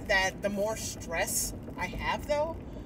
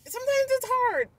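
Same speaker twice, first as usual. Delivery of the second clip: sometimes it's hard said higher and louder, sometimes stressed; higher first take; 5 dB louder than usual. Real, not acted. Repeats the phrase twice.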